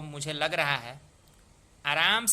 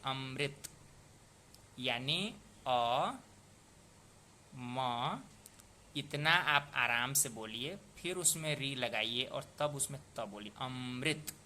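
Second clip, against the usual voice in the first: slow relaxed pronunciation; slower than usual. Shown as a demonstration. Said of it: "आप इसको आराम से बोलिए".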